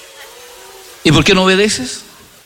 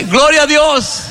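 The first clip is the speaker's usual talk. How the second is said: shouted praise; 8 semitones higher, slower than usual. Retold or imitated. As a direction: burst out "Gloria a Dios" with joy. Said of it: "Y después al final dice".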